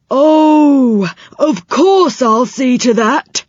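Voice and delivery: put-on high, loud, jolly old man voice; cheery saucepan man promising help. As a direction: reassure the children brightly.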